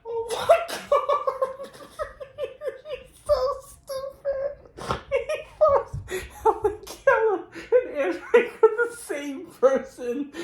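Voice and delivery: very high voice, cracking with laughter; laughing fit. Real, not acted. Viewer retelling the comedian's mix-up while laughing hard. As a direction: squeal it out through tears of laughter.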